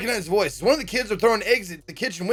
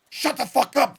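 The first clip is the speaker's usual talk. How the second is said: mild shout; 7 semitones higher than usual. Acted. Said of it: "the kid shouts".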